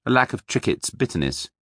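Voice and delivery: plain reading voice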